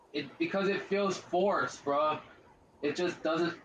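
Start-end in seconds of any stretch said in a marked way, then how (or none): none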